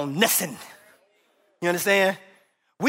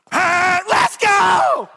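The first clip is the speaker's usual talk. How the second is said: high, loud yell at the slow driver; impatient shout; 10 semitones higher, 7 dB louder, much slower than usual. Retold or imitated. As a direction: blast it like you're stuck at a green light. Impatient driver at traffic light.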